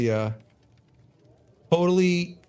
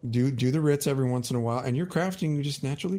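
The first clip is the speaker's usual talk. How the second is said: ordinary talk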